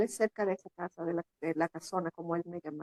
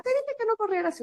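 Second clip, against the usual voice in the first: scolding; 14 semitones higher, 4 dB louder than usual. Retold or imitated. Mother scolding the daughter.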